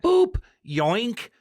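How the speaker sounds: cartoonish mock cry of a reckless snake handler; imitating snake handler. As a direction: bounce out the sounds like a goofy wildlife guy. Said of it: "and go".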